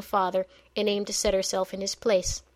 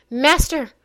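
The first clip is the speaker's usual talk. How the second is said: sharp high alarmed cry; 6 semitones higher, 10 dB louder than usual. Acted. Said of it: "Aiku cried out in alarm".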